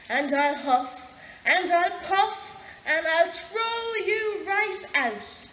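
slow, loud threat climbing in pitch; drawn-out, mounting bluster. A narrator's reading. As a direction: puff up into a storybook shout. Pig threatens the wolf in story.

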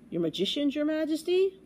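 worried question climbing high at the end; mild trembling alarm. A narrator's reading. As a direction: ask it as an alarmed page boy. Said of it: "Bartholomew shivered".

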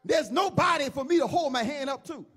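preacher voicing God. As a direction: proclaim it as God, in measured bursts. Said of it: "God says".

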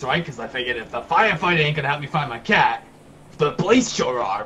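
ordinary talk, nothing marked

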